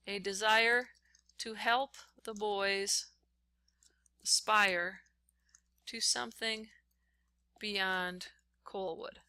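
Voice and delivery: slow, word-by-word dictation with long pauses. Shown as a demonstration. Teacher reads the quote aloud to the class while typing it, slow and halting. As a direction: spell it out as you type each word.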